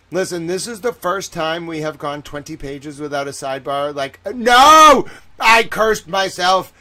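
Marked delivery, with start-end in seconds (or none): 4.3-6.7 loud drawn-out wail of self-blame; mock anguished cry. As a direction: cry out as if you jinxed it. Host jinxed the sidebar streak.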